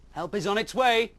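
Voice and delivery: rally them, sure of yourself; heroic Mister Bear. Acted. Bear promising the rabbit help, loud and bold.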